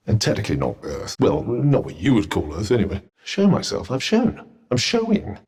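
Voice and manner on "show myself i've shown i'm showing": high, incredulous protest; indignant, pitched up in disbelief; affronted. Acted. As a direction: protest in short, raised bursts. Affronted at being told to appear.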